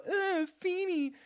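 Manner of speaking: high, slow, mock-innocent whine; mock simpering plea. Mocking the killer girlfriend's fake plea. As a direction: wheedle like a fake victim.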